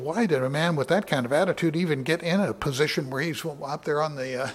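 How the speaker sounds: ordinary talk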